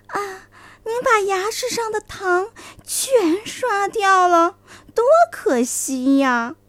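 coaxing fox voice. Acted.

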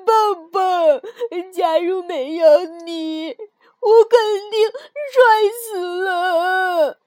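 slow, wailing, tearful confession; crying out loud. Acted. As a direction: sob the words out to your friend. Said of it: "跳跳放声大哭起来".

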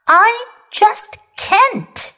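acted childish grumble; grumbling. A narrator's reading. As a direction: complain like a child refusing bedtime. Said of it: "he grumbled".

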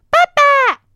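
put-on high, loud child's cry; a little dinosaur yelling for daddy; baby hugging a giant's leg and calling out; shouting "Dad!". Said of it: "大叫道".

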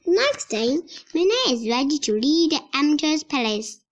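usual speaking voice

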